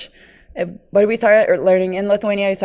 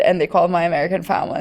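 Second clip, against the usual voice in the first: usual speaking voice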